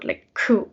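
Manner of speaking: ku from the back of the throat; throaty ku. Shown as a demonstration. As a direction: push ku from the throat. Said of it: "it has to come from the throat".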